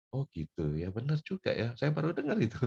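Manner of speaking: quietly concede, pitch rising; dawning realisation. Retold or imitated. Preacher conceding a friend's point, softly surprised.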